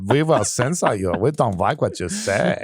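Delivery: put-on slow, comic German accent; little German guy. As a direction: play a tiny German censor, drawing out the words. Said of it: "little guy in a mustache".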